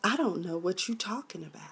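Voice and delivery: soft unvoiced innocent whisper; hushed feigned innocence; mock whisper. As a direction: whisper it, playing dumb. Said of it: "she was like".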